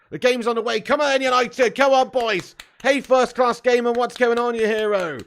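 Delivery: loud, high excited shouts; pumped cheering. Real, not acted. Streamer cheering United on, loud excited shouting.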